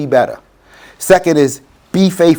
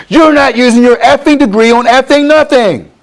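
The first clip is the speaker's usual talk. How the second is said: high, loud imitation of a yelling heckler; mock angry heckler; 9 semitones higher, 4 dB louder than usual. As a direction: mimic the stranger yelling in his face. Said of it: "he's yelling at me".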